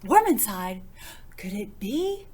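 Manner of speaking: building to a loud, high joyful cry; rising excitement. A narrator's reading. The reader telling children the spark is back, building to a joyful cry. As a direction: let hope build to a burst.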